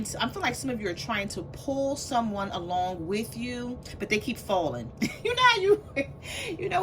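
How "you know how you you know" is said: helpless high-pitched laughter breaking into the words; laughing. Real, not acted. Reader cracking up at the cards, laughing as she talks. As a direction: talk through a fit of laughter. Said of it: "I don't mean to laugh".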